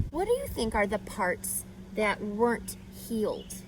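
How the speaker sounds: usual speaking voice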